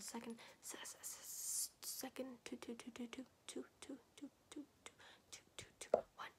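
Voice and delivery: whispered repetition fading to silence; soft quick whispered triggers; fast whispering asmr. Acted.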